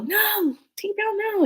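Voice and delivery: high, pleading call to stop the cat; urgent high plea. Retold or imitated. Telling her cat to stop, high urgent plea. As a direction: cry out high to hold the cat back.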